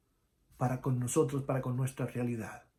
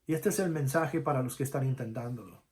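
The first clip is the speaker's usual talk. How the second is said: usual speaking voice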